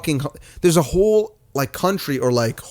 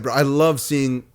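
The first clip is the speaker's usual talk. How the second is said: usual speaking voice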